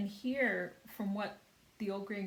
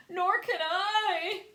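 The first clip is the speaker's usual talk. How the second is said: high, loud, slow wail; 11 semitones higher, 10 dB louder, much slower than usual. A narrator's reading. Glowworm wailing she can't swim either. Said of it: "wailed the glowworm".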